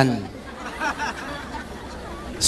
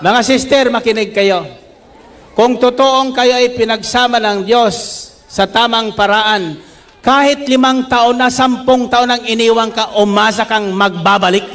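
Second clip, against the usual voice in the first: fiery preaching; 5 semitones higher than usual. Acted. Pastor exhorting the congregation, fiery preaching. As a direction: preach it out, rising and pressing.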